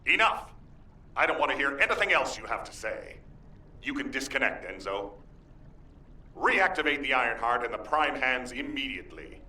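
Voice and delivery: commanding, out of patience; angry outburst. Acted. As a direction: cut them off sharply and give orders. Commander cuts off the guide, orders.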